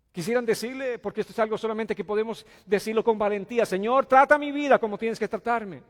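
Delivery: loud, high, rising preacher's appeal; preaching at full force. Acted. Preacher urging the congregation, loud and high. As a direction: lift the plea with full force.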